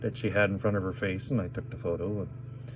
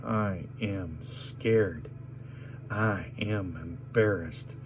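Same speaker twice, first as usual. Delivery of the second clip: softer, slow, shaken admission; shaken quiet; 7 dB quieter, slower than usual. Real, not acted. Admitting his fear to viewers, softer and slow. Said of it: "my anxiety inside of me".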